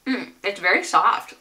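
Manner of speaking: chewing while talking; speaking around food; mouth full. Real, not acted. Seen 0: Bites bar at -2; cheeks and lips show chewing early on.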